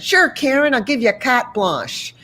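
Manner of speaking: playful Boston accent impression; laughing mock Boston accent. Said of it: "He said with his Boston accent".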